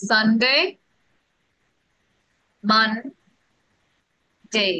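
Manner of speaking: each day said slowly and carefully, Wednesday as "ওয়েডনেসডে" squeezed to wens-day; model pronunciation. Shown as a demonstration. Teacher models weekday names for her students, slow and careful. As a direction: spell out the sounds like a teacher drilling the class. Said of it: "উচ্চারণগুলো দিচ্ছি আমি".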